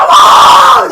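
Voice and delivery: very high, very loud martial arts yell; mock Bruce Lee battle cry; kung fu shout. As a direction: scream like Bruce Lee striking. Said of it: "Bruce Lee comes out".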